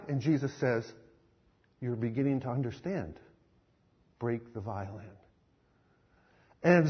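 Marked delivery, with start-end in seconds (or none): 1.8-5.2 soft firm command. Acted. Jesus softly repeats his command to Zoltan. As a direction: lay it down gently but firmly.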